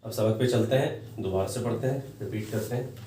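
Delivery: normal talking voice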